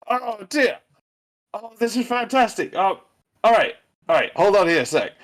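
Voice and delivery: put-on overjoyed to see an old friend; delighted laughing. Trent hugging his mate, delighted and laughing. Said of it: "starts laughing. He's like".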